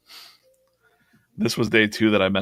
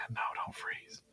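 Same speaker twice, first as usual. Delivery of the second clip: whispered plea; 14 dB quieter than usual. Real, not acted. Software about to freeze.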